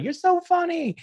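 high, gushing imitation of a fan; mock fan voice. Imitating friends who praise his Facebook jokes, high gushing voice. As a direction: squeal it like a fawning friend. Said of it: "they're like".

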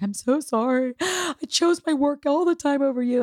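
high, gasping, tearful plea; mock sobbing apology. Mother apologizing to her daughter, tearful and high. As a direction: relive a breaking apology with a gasp. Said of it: "I was bawling to her".

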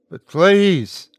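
high, loud "please"; desperate plea. A narrator's reading. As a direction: beg at the end of endurance. Desperate begging through door.

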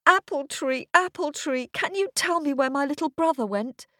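put-on high child voice calling out; girl calling up. A narrator's reading. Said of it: "she called up to the tree".

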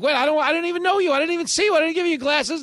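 very high, loud, fast protest; high frantic denial; panicked tourist. Acted. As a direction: babble your innocence in a panic. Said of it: "And he's like".

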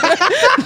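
bright real laugh; a short amused laugh. Real, not acted. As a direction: break into laughter. Laughs mid-sentence.

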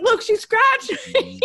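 very high, drawn-out mock complaint; mock complaining husband. Wife imitating husband tattling on toddler, high mock whine. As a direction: imitate a wounded husband, slow and squeaky.

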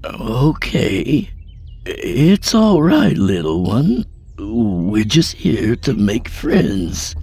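high, slow soothing voice; forced calm reassurance. A narrator's reading. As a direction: soothe it while scared inside. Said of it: "forced a smile".

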